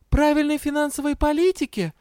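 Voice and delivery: very high, hesitant answer; tentative guess. A narrator's reading. Scolded heroine guesses what he taught her, tentative. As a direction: offer it like an unsure pupil.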